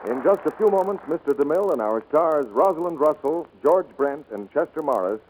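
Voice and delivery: usual speaking voice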